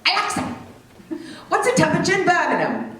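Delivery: high-pitched mock Cockney command; thick Cockney accent. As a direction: call out to a machine in thick Cockney. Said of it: "he's got that thick Cockney accent".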